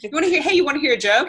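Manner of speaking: high, loud, over-keen interrupting; hammed-up attention seeker; eager pestering. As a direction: pester them to hear your joke. Acts pestering a celebrity with jokes.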